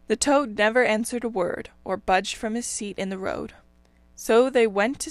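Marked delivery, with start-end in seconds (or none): none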